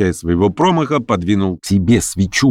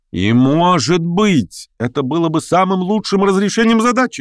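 heated, fervent; 12 semitones higher than usual. A narrator's reading. Shigalyov turns on Lyamshin, heated and fervent. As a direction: answer ardently in a much higher pitch. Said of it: "горячо обратился Шигалёв к Лямшину".

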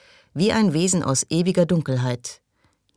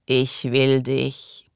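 very slow, eerie creature voice; slow otherworldly rasp of the creature; rotting creature; much slower than usual. A narrator's reading. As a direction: drag the words out like a dying ghoul. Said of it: "begann sie".

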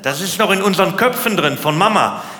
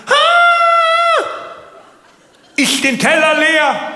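very high, loud squeal opening into a scolding; hammed-up nagging granny, pitched way up; grandmother voice; 4 dB louder, much slower than usual. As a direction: comedian mocks a grandmother urging a child to clear the plate, in a hammed-up high voice. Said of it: "die sagen".

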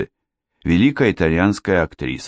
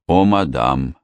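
plain reading voice

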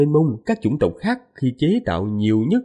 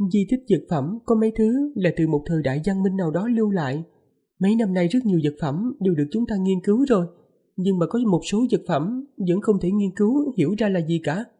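higher, lightly voiced young wife; man doing a woman's voice; 8 semitones higher than usual. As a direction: speak up high as the gentle wife. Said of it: "Lát giả giọng nữ".

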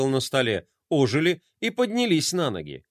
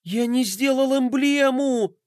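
a much higher, slow, dismayed cry; panicked boy; 9 semitones higher than usual. Acted. Roma realizes he never made the emblem, panicked wail. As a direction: wail it as the mistake sinks in.

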